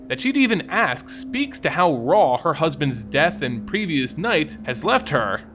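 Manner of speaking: ordinary delivery, nothing marked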